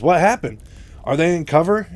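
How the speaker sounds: high, loud startled questions; very surprised. Retold or imitated. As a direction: act out urgent surprise. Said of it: "very surprised".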